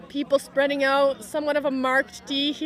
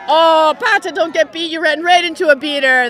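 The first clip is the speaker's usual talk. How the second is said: loud, raised groan of a commentator; higher, louder sideline reaction; dismayed play-by-play; 4 semitones higher, 9 dB louder than usual. Real, not acted. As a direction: call out to the player in dismay. Live reaction to player's mistake.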